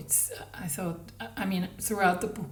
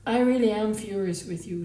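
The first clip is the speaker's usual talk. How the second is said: dead level voice; 2 semitones higher, 7 dB louder than usual. A narrator's reading. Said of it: "she said in a dead level voice".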